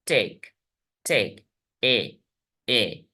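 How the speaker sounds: टेक short and falling, then its vowel ए alone; low, measured टेक, टेक, ए, ए; isolated syllable. Shown as a demonstration. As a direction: separate टेक with pauses, then sound just ए. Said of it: "तीन वेवल sounds हैं".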